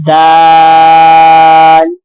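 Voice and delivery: دال chanted slowly, held for five counts; long daal. Shown as a demonstration. Teacher chants the long vowel in دال for the class. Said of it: "پانچ حرکات کے برابر کھینچیں گے".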